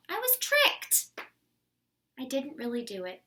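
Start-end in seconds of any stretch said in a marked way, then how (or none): 0.1-1.1 high, rising outrage; indignant protest. Acted. Wolf protesting he was tricked. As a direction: protest your innocence.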